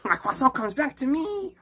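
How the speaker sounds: high cartoon French voice; French character. French character boasting of his croissant, high comic voice. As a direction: lilt it high like a silly Frenchman.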